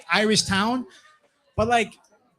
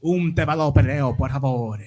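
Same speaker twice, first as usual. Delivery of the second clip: fake Italian; 6 semitones lower, 4 dB louder than usual. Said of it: "I'm like".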